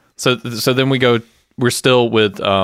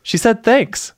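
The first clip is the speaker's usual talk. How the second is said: much higher, flat relay; Marissa relaying; 14 semitones higher, slower than usual. Retold or imitated. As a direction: fake a polite message. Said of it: "she goes".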